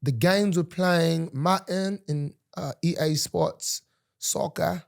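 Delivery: put-on London accent, dropped r's. Guest describing Tuesday's plans, mock London accent. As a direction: keep up a badly faked London accent. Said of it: "Is that a London accent".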